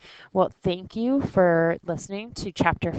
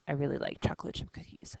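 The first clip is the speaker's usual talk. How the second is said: whispered aside; 2 semitones lower, 7 dB quieter, much faster than usual. Shown as a demonstration. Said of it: "confiding aside".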